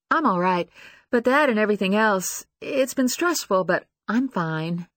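unsure, trailing reassurance; insecure young woman. Acted. Lucy voiced, acting stressed.

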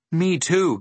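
put-on high-pitched complaint from a hungry boy; complaining. A narrator's reading. Milo with an empty stomach, complaining. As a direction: complain with a plaintive lift. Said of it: "complained Milo".

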